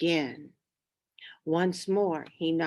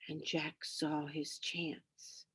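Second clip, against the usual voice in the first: whisper; 4 semitones lower, 14 dB quieter than usual. A narrator's reading. Sneaking past sleeping giant.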